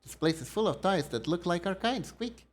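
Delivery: high, tiny squeaking critter voice; friendly little mouse, worried in a creepy place; squeaky mouse creature. Acted. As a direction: pipe it up like a little mouse folk. Voices squeaking mouse character dialogue.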